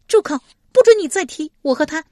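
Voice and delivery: high, loud furious woman; furious rebuke. A narrator's reading. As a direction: shout him down in a high voice. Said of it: "史佩忽然暴怒，斥道". (忽然暴怒，高声斥责)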